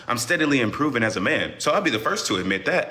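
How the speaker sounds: usual speaking voice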